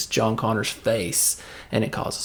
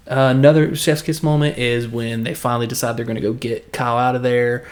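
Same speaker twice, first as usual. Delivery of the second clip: ordinary delivery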